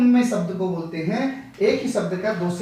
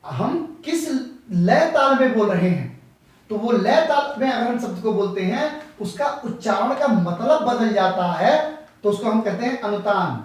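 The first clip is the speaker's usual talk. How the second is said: ordinary talk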